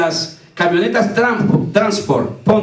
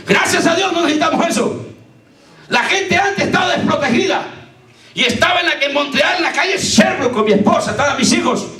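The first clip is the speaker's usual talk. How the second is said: full-force preaching; 8 semitones higher than usual. Acted. Preacher testifying to the congregation, full-force preaching. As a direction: preach it out with rising fervor.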